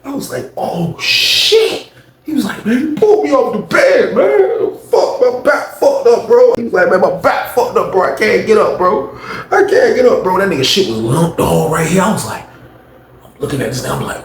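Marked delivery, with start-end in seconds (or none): 0.6-1.9 high, stretched gasp of disbelief; breathy "oh shit", replaying the moment; retold shock. 2.7-6.5 high-pitched aggrieved whine; hammed-up cry of a man in pain; mock injured wail. Imitating injured man's complaint. 6.9-10.4 high, strained complaint; imitated helpless victim; mock injured whine. Imitating injured man unable to rise.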